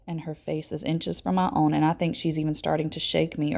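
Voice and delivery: plain reading voice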